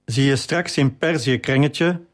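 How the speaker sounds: ordinary delivery, nothing marked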